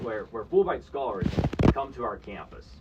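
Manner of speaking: normal talking voice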